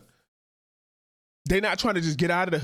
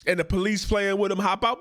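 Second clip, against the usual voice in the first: ordinary talk, nothing marked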